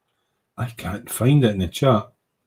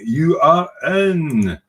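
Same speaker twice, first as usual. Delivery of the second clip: slow announcement; 5 semitones higher, much slower than usual. Real, not acted. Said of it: "admitting a member, drawn out".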